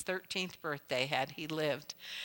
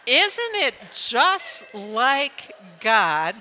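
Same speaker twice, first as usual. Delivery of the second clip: loud, high, fervent preacher voice; preaching at full force; 7 semitones higher, 13 dB louder than usual. Real, not acted. Preacher lifting the crowd with fervor, loud sermon voice. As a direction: rouse the whole congregation.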